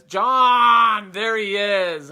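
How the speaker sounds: loud, high call of the name; excited shout. Real, not acted. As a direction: greet him as he pops on. Guest appears, calls name out.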